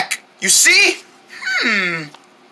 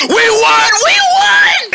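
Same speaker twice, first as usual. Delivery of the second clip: shrieking victory cheer, very high; excited cheering; 11 semitones higher, 3 dB louder, slower than usual. Pretend. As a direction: celebrate the win at the top of your voice. Celebrating winning the game.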